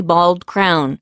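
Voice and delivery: ordinary delivery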